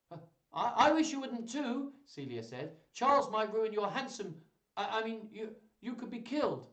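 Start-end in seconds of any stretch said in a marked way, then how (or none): 0.1-1.9 put-on high, louder girlish plea; worried young lady. Voicing Celia's worried plea. 2.9-6.7 high-pitched, stumbling concern; flustered young lady. Acted. Voicing Celia, flustered correction.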